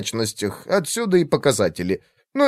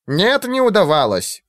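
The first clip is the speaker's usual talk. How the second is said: mocking imitation; 7 semitones higher than usual. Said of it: "передразнил её Брастер".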